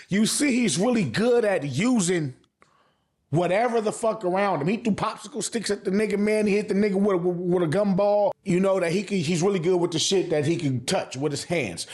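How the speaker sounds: ordinary talk, nothing marked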